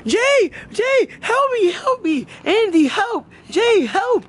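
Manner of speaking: hammed-up plea for rescue; mock drowning cry. Shown as a demonstration. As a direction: act out a panicked kid calling for help. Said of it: "You gotta say".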